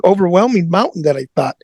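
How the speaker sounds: ordinary talk, nothing marked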